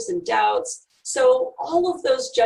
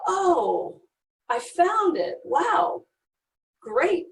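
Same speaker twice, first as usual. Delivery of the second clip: mock delight; 6 semitones higher, much slower than usual. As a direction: play at being thrilled you found it. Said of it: "just go".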